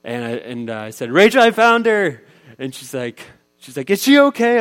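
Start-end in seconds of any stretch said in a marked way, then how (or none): 1.1-2.2 high, loud call to another room. Retold or imitated. Father calling to his wife that he found their daughter. 3.9-4.6 high, loud worried call; anxious call. Retold or imitated. Mother calling down, frantic about her daughter.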